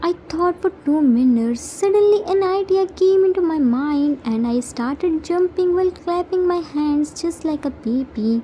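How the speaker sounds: plain reading voice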